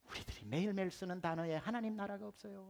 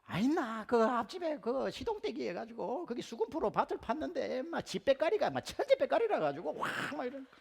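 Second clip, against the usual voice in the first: high, louder, rapid countryside Gyeongsang chatter; rural Gyeongsang aunts; 8 semitones higher, 5 dB louder than usual. Retold or imitated. Aunts gossiping at night, thick rural Gyeongsang dialect. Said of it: "자매들이 모여서 얘기를".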